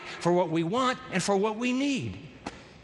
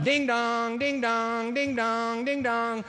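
doorbell chime; 4 semitones higher, much slower than usual. Acted. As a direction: ring like an impatient doorbell. Friend ringing doorbell at midnight.

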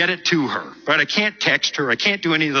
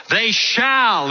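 "shall" punched up, preacher style; stress on shall; 5 semitones higher than usual. Retold or imitated. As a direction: lean hard on "shall" like a fiery preacher. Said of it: "he really emphasized the word shall".

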